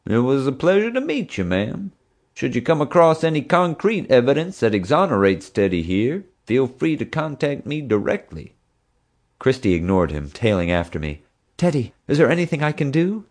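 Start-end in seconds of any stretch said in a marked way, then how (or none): 0.1-8.5 a lightly voiced puffed-up officer, pitched higher; pompous deputy. A narrator's reading. Bubba's gallant goodbye. 11.6-13.2 a lightly voiced earnest young woman, pitched higher; higher, insistent young keeper; young zookeeper. A narrator's reading. Christy offers help.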